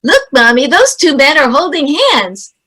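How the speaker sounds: put-on high, carrying little-kid call; little kid megaphone voice. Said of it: "in that little megaphone voice".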